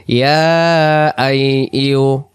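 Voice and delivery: ইয়া আই ইউ each vowel stretched slowly; drawn-out syllables. Shown as a demonstration. Teacher drilling the class on long vowels. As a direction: hold every vowel long and even. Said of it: "সবাই টান দেন".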